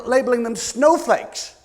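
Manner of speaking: usual speaking voice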